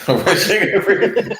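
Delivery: laughing. Real, not acted. Laughs at the beat suggestion.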